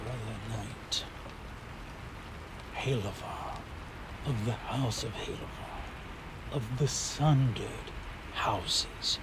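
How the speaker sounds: very soft, low murmur; hushed, weary-sounding low voice; sighing knight. A narrator's reading. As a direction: sigh it out quietly with long pauses. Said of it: "sighs and says".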